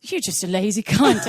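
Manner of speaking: mock Scottish telling-off; attempted Scottish accent. Guy teasing her for having Audible, attempted Scottish accent. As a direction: scold in a rough attempt at Scottish. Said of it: "I can't even do the accent right now".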